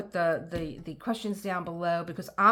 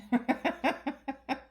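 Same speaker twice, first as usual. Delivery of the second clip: laugh; 7 semitones higher than usual. Real, not acted. Laughing at memory of Anthony.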